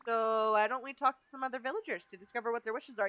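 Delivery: normal talking voice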